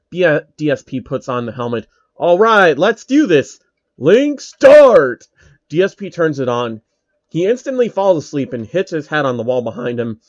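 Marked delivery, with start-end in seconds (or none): 2.2-5.3 hyped-up start. Acted. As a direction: pitch climbing, louder and louder to a shouted finish. Gamer psyching up to start.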